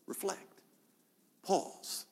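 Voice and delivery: soft, breathy whispered single words; hushed call. Acted. Preacher asking listeners to pause, in a whisper. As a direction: drop to a whisper to make them stop. Preacher calling for quiet reflection.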